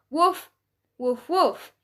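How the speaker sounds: usual speaking voice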